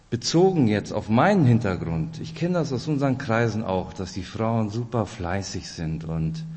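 ordinary talk, nothing marked

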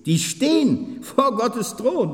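slow it right down and lift it; solemn slowed declaration; emphatic correction. Real, not acted.

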